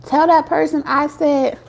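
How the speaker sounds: ordinary delivery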